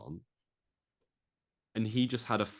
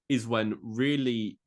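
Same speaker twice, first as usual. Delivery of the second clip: usual speaking voice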